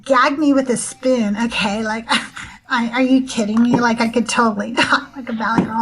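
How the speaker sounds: put-on Valley girl accent. Shown as a demonstration. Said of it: "I can talk like a Valley girl".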